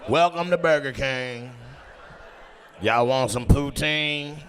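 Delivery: slow, drawn-out fast-food greeting; hammed-up restaurant announcer voice; mock counter worker. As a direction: call it out like a droning server. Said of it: "You call out the orders?".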